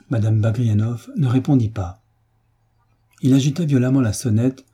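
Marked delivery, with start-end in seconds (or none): none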